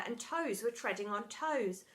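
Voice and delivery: plain reading voice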